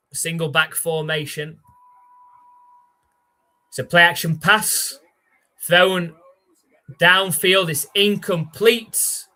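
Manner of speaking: lively sportscaster calling the snap; play-by-play call. Acted. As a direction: call the play like a TV announcer, building as the ball flies. Live play-by-play calling the game.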